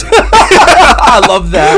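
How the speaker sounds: high, drawn-out gushing; delighted squeal. Real, not acted. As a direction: stretch it out, thrilled. Delighted reaction to the story.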